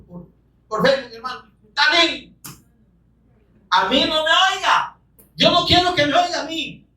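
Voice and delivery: high, fervent sermon voice; full-force preaching. Acted. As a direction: preach it out, lifted high and urgent.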